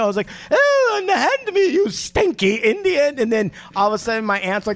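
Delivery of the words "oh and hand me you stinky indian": high, slow imperial British voice; hammy stage actor. Retold or imitated. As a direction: ham up a pompous British colonial. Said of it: "I did some British voice, some imperial".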